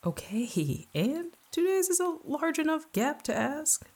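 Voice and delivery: high, slow, coaxing self; pleading inner voice. A narrator's reading. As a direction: argue your case to yourself. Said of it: "two voices in my head started arguing".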